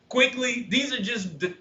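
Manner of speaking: ordinary delivery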